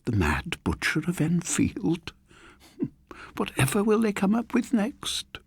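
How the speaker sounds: put-on high, quavering old woman's voice with a chuckle; amused old lady. A narrator's reading. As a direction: scoff at the silly headline like an elderly lady. Old lady scoffs at headline.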